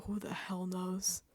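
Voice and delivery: slow, whispered aside of despair; exasperated whisper. Retold or imitated. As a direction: mutter it under your breath, slowly. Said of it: "I'm also looking at this jury".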